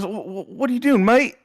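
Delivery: hammed-up British mate; British accent. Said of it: "Peter Dunne goes".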